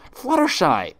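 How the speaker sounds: high, loud desperate call; near shout. Acted. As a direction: call out to her last hope. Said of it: "Twilight nearly shouted".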